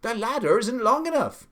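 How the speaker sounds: high, loud, protesting call; gardener calling back. A narrator's reading. Gardener up ladder to the Duke, calling back. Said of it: "the gardener called back".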